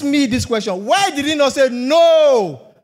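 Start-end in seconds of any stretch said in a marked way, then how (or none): 1.7-2.7 a sudden, louder, flat-out no; firm refusal. Acted. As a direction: slam the door on the request. King refusing the daughter's request.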